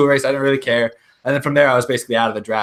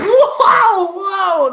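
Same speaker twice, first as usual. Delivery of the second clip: excited whoa; 6 dB louder than usual. Retold or imitated. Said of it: "I was basically just like".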